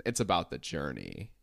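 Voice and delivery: low smoky voice; [name] voice. Retold or imitated. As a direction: go low and husky. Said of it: "decided to talk like this".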